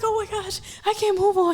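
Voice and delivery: high-pitched mock sobbing plea; retold crying. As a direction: re-enact tearful despair up high. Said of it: "And I start crying".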